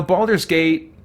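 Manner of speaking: ordinary delivery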